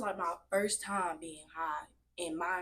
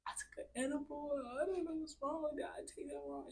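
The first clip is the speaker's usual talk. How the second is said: high, softer imitation of a tearful confession; mock crying; 9 semitones higher, 4 dB quieter than usual. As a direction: act out blurting it tearfully in a high voice. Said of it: "I start crying".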